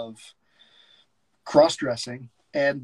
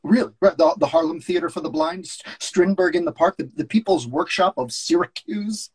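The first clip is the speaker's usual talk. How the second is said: mocking, a bit louder; lightly voiced sneering agent; sarcastic agent; 5 semitones higher, 5 dB louder than usual. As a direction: list his flops with scorn. S0 reads George.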